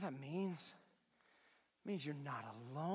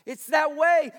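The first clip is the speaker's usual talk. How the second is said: preaching; 11 semitones higher, 17 dB louder, slower than usual. Acted. Preaching to congregation.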